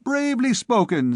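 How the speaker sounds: very high-pitched mock cheer; crying out. A narrator's reading. Little student mocking the furrier, crying out high. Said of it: "cried the little student".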